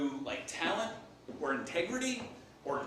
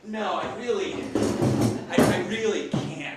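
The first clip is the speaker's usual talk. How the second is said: loud, hammed-up protest, slowing on the repeat; emphatic mock refusal; 11 dB louder than usual. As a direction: refuse with big theatrical reluctance. Acted mock protest, escalating.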